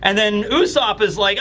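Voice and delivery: ordinary talk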